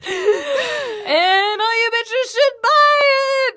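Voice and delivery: high, drawn-out triumphant yell; gleeful shout. Real, not acted. Host plugging a new book to listeners, gleeful shout. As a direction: holler it slowly, stretching the words.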